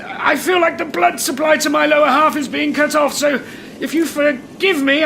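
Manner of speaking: high, tense explanation; strained restraint. Acted. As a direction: keep politeness while being squeezed. Trapped manager in pain, restraining himself.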